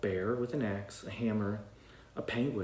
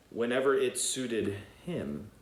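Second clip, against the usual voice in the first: usual speaking voice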